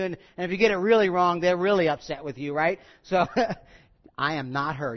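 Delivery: ordinary delivery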